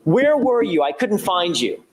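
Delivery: retold accusation, pitched high; mock accusing demand, voice up high. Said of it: "He accuses her".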